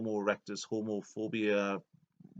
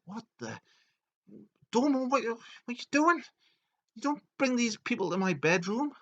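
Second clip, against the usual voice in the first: very high, loud indignant boss voice; outraged scolding; 13 semitones higher, 4 dB louder than usual. Retold or imitated. Boss scolding Domo over strangers in bedroom, high outrage. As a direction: scold in a high-pitched mock fury.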